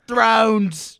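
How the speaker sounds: loud, imitated Boston vowel; mock Boston accent. Teasing a friend over how he said it, mock Boston accent. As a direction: mimic a friend's accidental Boston twang, loudly. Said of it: "like, a Boston accent".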